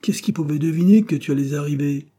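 high, loud protest; cried out. A narrator's reading. Wife blaming husband for coming in, loud cry. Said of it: "cria-t-elle".

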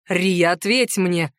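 low, slow demand. A narrator's reading. Centaur demanding an answer from Riya, low and insistent. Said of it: "потребовал он".

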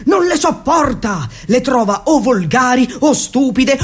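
usual speaking voice

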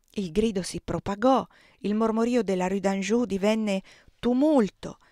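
ordinary delivery

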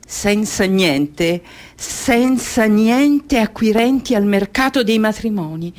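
slow, drawn-out proclamation; emphatic lament. Acted. Girl without anything for the marriage market, emphatic lament. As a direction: stretch the words out, swelling as you go.

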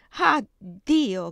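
a sudden anguished cry of alarm. Acted. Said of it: "gridò Corinna". (grido angosciato)

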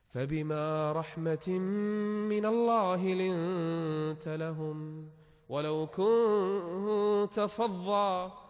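very slow, drawn-out chanted recitation; Quran recitation. Acted. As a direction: stretch every word like tajwid chanting.

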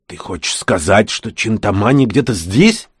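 plain reading voice

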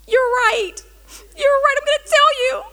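hammed-up wail, very high-pitched; crying. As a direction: blurt it out in mock tears. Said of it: "And I'm just crying".

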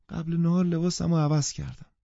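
put-on high, eager little-girl voice; little child. A narrator's reading. Child turns eagerly to her mother, in a childish voice. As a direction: pipe up like a small child.

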